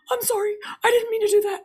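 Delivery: put-on high, fast, small-child "I'm sorry"; little girl apology. Mom voicing her daughter's hurried apology, high and fast. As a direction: rush it out like a scared little kid.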